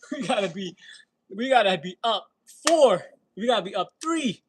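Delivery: loud, high and excited; loud excited fan. Real, not acted. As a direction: get fired up about the Knicks. Excited Knicks hopes.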